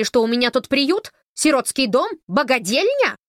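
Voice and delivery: громкая сердитая тирада; отчитывать, повышая голос; сердито. Acted. Сварливая старуха отчитывает Тессу. (старуха громко возмущается по телефону)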